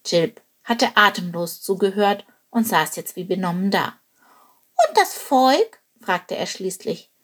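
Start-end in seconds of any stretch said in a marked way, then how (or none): 4.9-5.8 high, light bird voice; small eager bird asking; little bird voice. Acted. Little bird Chilp asks.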